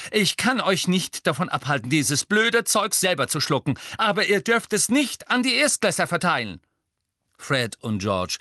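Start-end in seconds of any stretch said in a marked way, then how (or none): 0.1-6.6 high, loud, forceful ultimatum; grim scolding. A narrator's reading. Hermine forbidding twins to use first-years, grim. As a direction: lay down the rule angrily. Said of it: "sagte Hermine grimmig".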